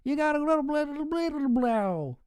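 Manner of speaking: high-pitched, frantic ranting radio host; mock conspiracy rant. Streamer imitates Alex Jones yelling at the sheeple, high hammed-up rant. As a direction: shake them awake with a shrill, hammed-up rant. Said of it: "I just wanna shake them and go".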